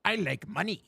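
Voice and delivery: greedy cartoon crab voice; halting cartoon impression, softly done; Mister Krabs. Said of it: "doing a Mister Krabs impression".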